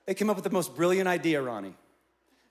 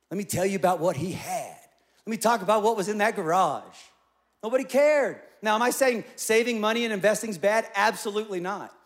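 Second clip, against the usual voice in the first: full preaching; 4 semitones higher, 8 dB louder than usual. Acted.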